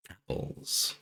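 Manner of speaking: breathy hushed reveal of apples; whispered apples. Pretend. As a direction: drop to a hush like a secret topic. Dramatic whispered topic reveal.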